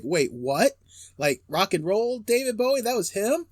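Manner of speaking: incredulous, voice rising sharply at the end; surprised disbelief. Retold or imitated. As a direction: replay a baffled double take. Acting out surprise on learning singer.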